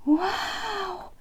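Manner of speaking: high excited wow; amazed friends gasping wow; excited exclaim. A narrator's reading. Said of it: "they both exclaimed".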